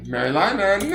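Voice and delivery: high, loud cry of the name; squealed drag queen call-out; drag queen exclamation. Pretend. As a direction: shout it out like a diva hosting a show. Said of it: "I like to speak in a throaty drag queen voice".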